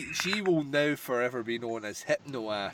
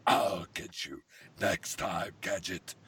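slow, low villain growl; Doctor Claw; 6 semitones lower, slower than usual. Retold or imitated. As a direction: lower menacing cartoon villain. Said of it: "sound like Doctor Claw".

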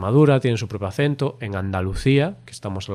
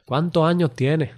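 a higher, slower try at Andalusian that sounds strange; odd imitation accent; 5 semitones higher than usual. Shown as a demonstration. Learner trying Andalusian for a friend, an odd accent. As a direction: copy the Andalusian line, a bit off. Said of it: "pones un acento muy extraño".

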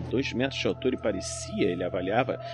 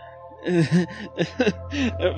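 nervous laugh; 7 semitones higher than usual. Acted. Nervous laugh under threat.

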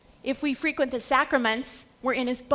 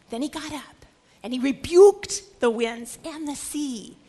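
usual speaking voice

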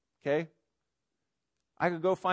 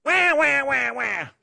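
high, loud mock wailing; mock crying; 9 semitones higher, 6 dB louder, slower than usual. Preacher mocks the complaining people, exaggerated crybaby wail. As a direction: wail like a bawling baby, hammed up. Mimics whining after complaint.

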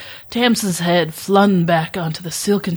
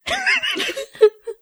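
high crying laugh. Real, not acted. Author about to read her fanfic aloud, whimpering laugh. Embarrassed to start reading.

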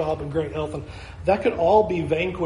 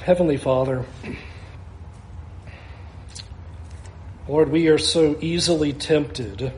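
prayer. Acted. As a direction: lead the congregation in prayer. Leads a closing prayer.

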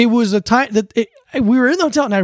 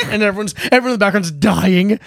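usual speaking voice

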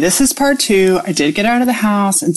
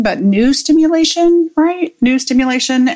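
stimulation raised high and stressed; emphatic correction; 6 semitones higher than usual. Real, not acted. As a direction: lean hard on new stimulation.

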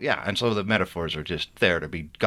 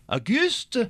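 put-on much higher call with a French accent; a mother calling her boy, in a French accent. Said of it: "cried Annie".

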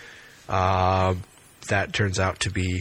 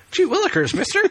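put-on gee-whiz kid; 3 dB louder than usual. Old-timey kid exclamation.

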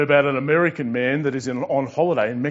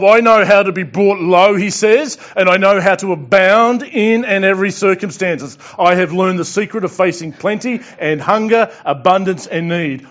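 loud, raised preaching read; scripture reading; 4 semitones higher, 7 dB louder than usual. Real, not acted. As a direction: proclaim the verse to the congregation.

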